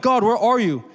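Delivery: retold yell. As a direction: call out to God, half-shouting, slowing down. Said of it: "but I'm, like, yelling".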